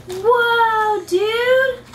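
high and loud, amazed; excited whoa. Real, not acted. Mom showing her son the art kit, thrilled.

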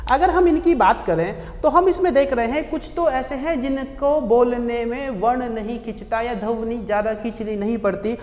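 ordinary talk

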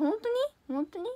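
high, loud, delighted question; thrilled by a fan's words; delighted surprise. Real, not acted. Idol hears a fan likes her, high delighted surprise.

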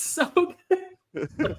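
slow, high, through laughter; laughing. Real, not acted. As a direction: giggle the words out. Laughing about the scene.

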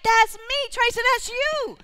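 high, bright burst of recognition; thrilled discovery. Retold or imitated. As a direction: let the discovery explode upward. Discovering her gift, exploding inside.

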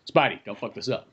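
low, put-on confidant voice; lightly acted warning. Imagined friend with hand on Spidey's shoulder, low mock warning. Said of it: "somebody puts his hand on his shoulder".

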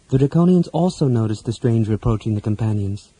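ordinary delivery, nothing marked

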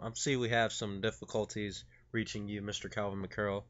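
ordinary talk

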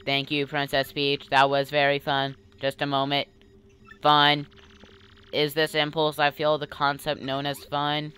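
level, monotone machine musing; computer voice. Acted. Computer thanking the princess for a dance, monotone. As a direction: keep it even, word by word. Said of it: "I'll do the voice".

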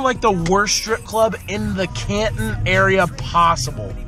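ordinary talk, nothing marked

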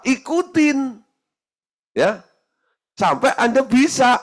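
high, loud exhortation; rally the crowd to believe; preaching at full force. Acted. Preacher urges the congregation to copy the examples, preaching loudly.

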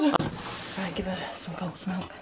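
hushed unvoiced whisper; breathless whispered instruction. Real, not acted. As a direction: whisper it so as not to disturb. Aside during tense whelping.

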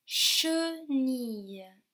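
"Chenille" spoken very slowly, each syllable drawn out. Shown as a demonstration. Teacher to class, slowly sounding out the syllable che. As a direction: stretch "Chenille" out so che stands out. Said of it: "Oui, che".

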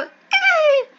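high-pitched dolphin squeal; dolphin noise. Retold or imitated. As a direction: squeal like a dolphin as a censor bleep. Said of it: "censor it out with dolphin noises".

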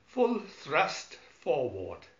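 slow firm order; slow command. Acted. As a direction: give the order slowly. Command at controls.

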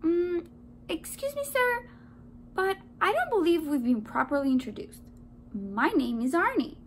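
high, sugary little voice; sweetest voice. A narrator's reading. Donut sweet-talks the man to hide plan, sweetest voice. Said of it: "said in his sweetest voice".

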